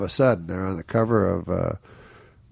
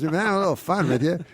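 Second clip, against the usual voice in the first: higher, chummy mock organizer; quoting the prankster; 8 semitones higher, faster than usual. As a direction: sound pleased with the prank, pitched up.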